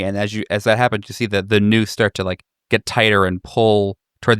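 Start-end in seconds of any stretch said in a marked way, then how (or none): none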